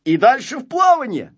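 high, loud, confident rallying; rally your friend with full assurance. A narrator's reading. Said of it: "сказал Незнайка уверенно".

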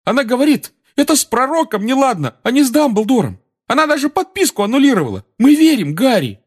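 very high-pitched earnest voice; simple, emphatic loyalty in falsetto; Neville, artless. A narrator's reading. Neville declaring his family believes Harry, artless falsetto. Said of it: "бесхитростно сказал Невилл".

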